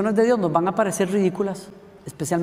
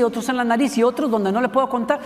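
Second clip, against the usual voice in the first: usual speaking voice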